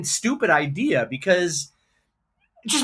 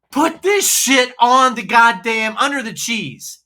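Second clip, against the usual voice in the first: exasperated outburst; 3 semitones higher, 6 dB louder, slower than usual. Real, not acted. Angry rant about the sauce.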